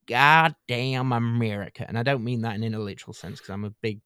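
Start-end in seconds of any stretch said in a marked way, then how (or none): 0.1-1.8 drawn-out hammed-up American drawl, louder; louder, stretched-out mock American exclamation; mock American accent. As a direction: curse it slowly like a caricature American.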